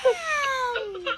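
high, loud mock "ow"; imitated toy cry. Child showing what the toy does, mock cry. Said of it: "she actually just goes".

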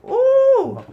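high loud startled squeal; surprised squeal. Real, not acted. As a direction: yelp in sudden surprise. Surprised exclamation.

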